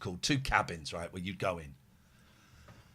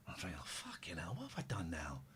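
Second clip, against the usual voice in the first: worried whisper; 9 semitones lower, 18 dB quieter than usual. Retold or imitated. Retold inner worry, whispered.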